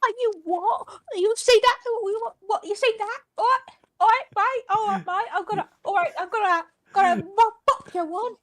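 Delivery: high, sputtering angry Cockney; attempted Cockney accent. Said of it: "That's my attempt at being a Cock".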